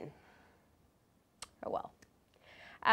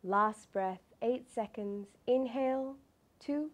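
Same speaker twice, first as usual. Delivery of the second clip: calm meditative tone; 6 dB quieter than usual. Acted. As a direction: count the breaths gently and evenly. Leads a breathing meditation.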